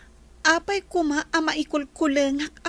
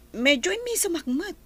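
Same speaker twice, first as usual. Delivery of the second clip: ordinary delivery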